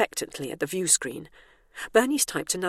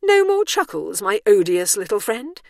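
patronising; 4 semitones higher, 7 dB louder than usual. A narrator's reading. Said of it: "with a little patronising shoulder shrug".

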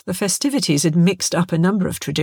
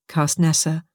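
ordinary delivery, nothing marked

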